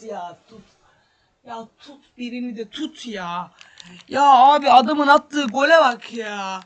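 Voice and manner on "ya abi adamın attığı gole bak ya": loud, slow, disbelieving shout; exasperated loud complaint; outraged yell. Real, not acted. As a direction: roar at the goal he just let in. Outraged at a conceded goal.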